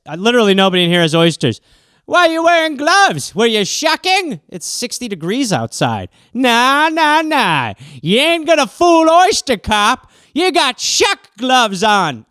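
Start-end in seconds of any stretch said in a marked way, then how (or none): 2.1-12.3 higher, louder suspicious grilling. Acted. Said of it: "so Oyster Cop".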